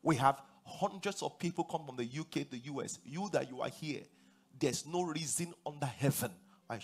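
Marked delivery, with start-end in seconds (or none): none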